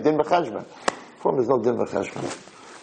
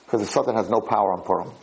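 ordinary talk, nothing marked

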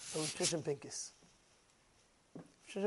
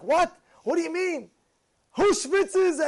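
incredulous; 8 semitones higher, 3 dB louder, slower than usual. Retold or imitated. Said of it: "And I was like".

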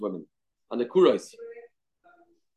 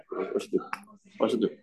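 hushed, fretful question; worried whisper; 5 semitones lower, 6 dB quieter than usual. Retold or imitated. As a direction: whisper it like a worried old man.

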